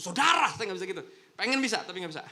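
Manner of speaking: loud burst, mock preacher yell; a loud, jokey preacher shout; half-shouted preaching. Shown as a demonstration. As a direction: belt it like a fiery preacher. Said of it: "susah kalau khotbah agak teriak-teriak".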